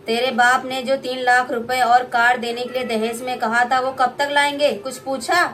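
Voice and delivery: mother-in-law harassing bride for dowry; greedy mother-in-law. Acted. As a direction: lay down the dowry demand.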